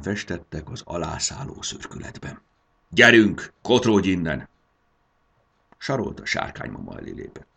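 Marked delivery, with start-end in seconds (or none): 2.9-4.5 much louder, harsh dismissal; a fuming dragon mother shooing off a human child; grumbling rage. Acted. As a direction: drive the girl away in anger. Dragon mother chases girl off.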